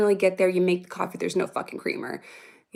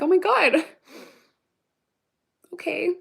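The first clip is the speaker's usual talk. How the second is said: high, louder gasp of disbelief; retold overwhelmed reaction, pitched way up; touched amazement; 9 semitones higher, 3 dB louder than usual. Mom hearing "I love you" from preteen, high and amazed.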